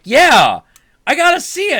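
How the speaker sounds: high, loud excited begging; high, loud eager little boy; eager kid. Retold or imitated. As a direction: plead like a thrilled child. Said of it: "And I'm like".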